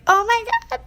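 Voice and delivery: high-pitched retold wail; squeaky mock-crying exclamation; mock tearful. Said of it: "I'm crying in the stall".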